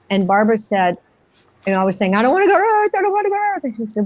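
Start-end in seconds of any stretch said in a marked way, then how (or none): 2.1-3.6 high-pitched pleading protest; reenacted dread. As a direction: act out an anxious refusal, voice way up high. Retelling dread under regression.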